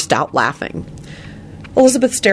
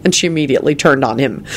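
plain reading voice

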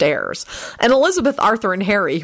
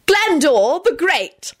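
high, drawn-out triumphant cry; high-pitched shout of victory; ecstatic shriek; 10 semitones higher, much slower than usual. A narrator's reading. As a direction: proclaim it high and slow, ecstatic. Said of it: "Elizabeth shrieked ecstatically".

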